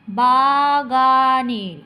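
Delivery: loud, hugely stretched syllables; slow motion. Shown as a demonstration. Teacher breaking a word into beats, slow-motion reading. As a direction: drag out every syllable for counting. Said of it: "ছন্দ করবে তখন slow মোশনে পড়বে".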